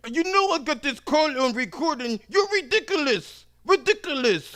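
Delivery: scornful customer. Retold or imitated. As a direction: sneer it down the line. Customer threatens.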